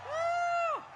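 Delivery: high, cheering woo; excited whoop. Real, not acted. New speaker greets the rally crowd with a high whoop.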